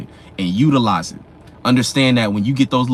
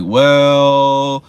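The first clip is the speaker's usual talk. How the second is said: drawn-out, louder hesitant "well"; Johnson stalling; 6 dB louder than usual. Retold or imitated. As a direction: stall like a coworker caught with nothing. Johnson presenting blank slide.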